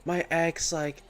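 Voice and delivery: lightly voiced whiny girlfriend; mocking girlfriend. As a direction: mimic her ex complaints mockingly. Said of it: "She's like".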